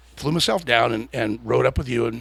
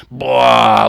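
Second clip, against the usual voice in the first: loud mimicked dragster launch; imitated engine roar; 10 dB louder than usual. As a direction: blurt out a big vocal engine noise. Imitates car launching down track.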